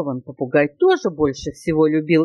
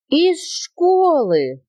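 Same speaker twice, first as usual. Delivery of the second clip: high, long-drawn horrified exclamation; shocked gasp; 11 semitones higher, much slower than usual. A narrator's reading. Baby elephant fearing boa is expelled, aghast. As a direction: gasp the words out high and slow. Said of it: "ахнул слонёнок".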